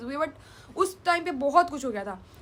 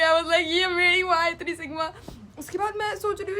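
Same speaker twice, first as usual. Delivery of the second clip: ordinary talk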